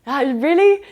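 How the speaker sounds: high, loud startled whoa; shocked surprise. Retold or imitated. Invited home by Lucy, high loud shocked surprise. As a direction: squeal it, caught off guard. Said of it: "I was like".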